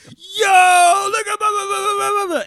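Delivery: loud, high jeering yell; mocking classmates. Classmates jeer at the freshman in line, loud and high. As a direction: pile on him like hyenas. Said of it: "They turned around like".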